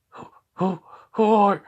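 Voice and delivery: high, stuttering fearful demon voice; scared creature stutter; frightened stammer. Acted. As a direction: stammer in panic as the demon backs away.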